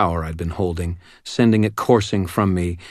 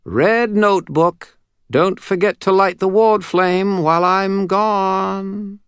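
high, slow, eerie spirit voice; oddly modulated spirit voice; 12 semitones higher, 5 dB louder, slower than usual. Acted. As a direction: float the words back like a distant ghost. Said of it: "Bob's voice, oddly modulated".

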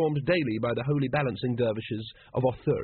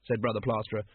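usual speaking voice

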